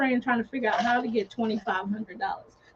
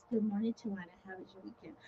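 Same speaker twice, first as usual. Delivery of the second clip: quiet greeting; 14 dB quieter, faster than usual. Retold or imitated. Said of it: "she says".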